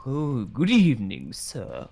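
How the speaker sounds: put-on posh British accent. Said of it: "he will turn British again".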